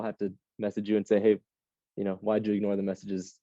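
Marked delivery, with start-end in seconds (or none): none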